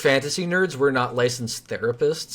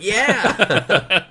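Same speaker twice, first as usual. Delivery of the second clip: high, loud, drawn-out cheer; gleeful loud confirmation; re-enacted excited yes; 9 semitones higher, 6 dB louder than usual. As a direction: burst out with an eager, raised affirmation. Acting out own excited answer.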